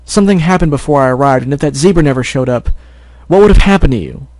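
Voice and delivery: angry scolding. Acted. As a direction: scold her hard, rising in anger. Angry brother scolds sister.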